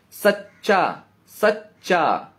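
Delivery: सच्चा said slowly, second take stretched; slow demonstration. Shown as a demonstration. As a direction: repeat सच्चा slowly to show the weight. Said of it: "बड़ा सुर है, सच्चा". (सच्चा धीरे-धीरे दोहराकर)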